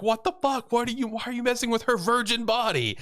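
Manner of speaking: very high-pitched mock outrage; outraged father. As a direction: imitate a protective dad squawking in disbelief. Retold outraged father confronting medics.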